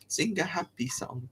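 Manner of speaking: hushed, playful little whisper; light whispered aside. Pretend. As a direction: whisper it softly to yourself. Chanting to himself playfully.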